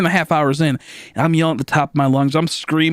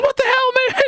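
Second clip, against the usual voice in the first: mock crying mom. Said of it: "she's like, starts crying".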